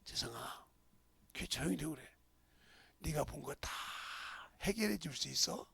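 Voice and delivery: put-on hushed, whispered rebuke to a crying boy; gentle whisper from the old pastor; quiet pastor. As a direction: lean in and speak softly. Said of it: "그러니까 울었단 말이야".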